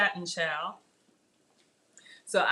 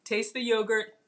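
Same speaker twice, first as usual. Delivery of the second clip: ordinary delivery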